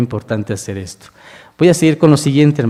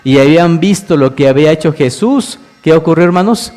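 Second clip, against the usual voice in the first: louder, driving sermon voice; fervent preaching; 3 semitones higher, 5 dB louder than usual. Acted. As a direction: preach the miracle to the congregation. Preaches the raising of Lazarus.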